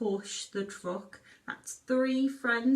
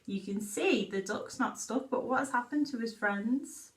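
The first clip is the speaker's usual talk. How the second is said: usual speaking voice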